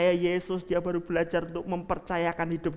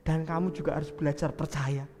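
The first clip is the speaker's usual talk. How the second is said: soft whisper; 6 semitones lower, 8 dB quieter than usual. Acted. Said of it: "Tuhan kemudian berbisik kepada Marta".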